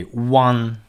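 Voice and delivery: one said slowly with a rounded w, WUN; clear W sound. Shown as a demonstration. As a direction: lips pushed round for the w in one. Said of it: "pay attention to say W sound".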